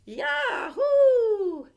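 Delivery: very high, loud whoop of joy; girl whooping on the toboggan; gleeful yell. A narrator's reading. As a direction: whoop it out sledding downhill. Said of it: "yelled Nikki".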